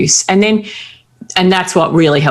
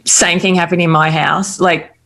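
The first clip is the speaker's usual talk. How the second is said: normal talking voice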